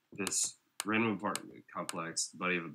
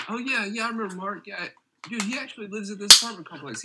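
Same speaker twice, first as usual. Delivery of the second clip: higher, eager retelling voice; imitating buddy; 12 semitones higher, 7 dB louder than usual. Buddy tells friends Mark lives right here, excited. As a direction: play the excited buddy spilling news.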